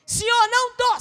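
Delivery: high, strained plea; pained refusal. Acted. Believer pleading with God in a high strained voice. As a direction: cry out that you're not ready.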